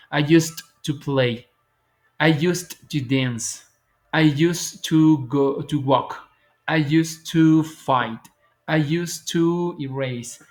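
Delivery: slow, careful drill of each sentence; slow model sentences. Shown as a demonstration. Teacher modelling used to for students, slow and careful. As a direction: spell out each sentence slowly for learners. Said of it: "puedes narrar ese mismo hecho y decir".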